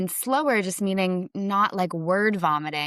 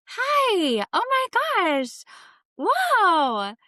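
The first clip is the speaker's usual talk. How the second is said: high nervous camera voice; 13 semitones higher, 4 dB louder, much slower than usual. Retold or imitated. Nervous newcomer greeting the camera in a very high voice. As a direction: voice shoots way up, high and bright. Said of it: "their voice goes up".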